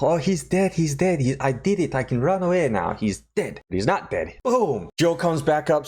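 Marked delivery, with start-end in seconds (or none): 0.0-3.6 gleeful doctor. Retold or imitated. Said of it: "He's like".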